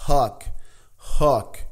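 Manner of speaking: hook with h and short vowel, slowly; English hook. Shown as a demonstration. Teacher models hook for students. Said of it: "é o hook".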